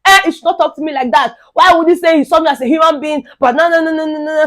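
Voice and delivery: high-pitched mock outburst; retold shouting that dissolves into gibberish; imitated shouting. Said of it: "Brian come start his own shout".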